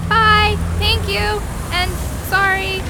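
loud, hurried farewell shout; calling back. Acted. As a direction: throw it over your shoulder while running.

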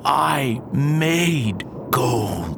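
slow, savoured announcement of victory; slow triumph. Acted. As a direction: let each word land slowly, proud. Triumph after years of alchemy.

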